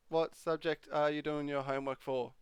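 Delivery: ordinary delivery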